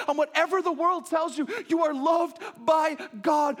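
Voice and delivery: high, loud passionate preaching; fervent pulpit proclamation; full-force preaching. Acted. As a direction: preach it out with fire.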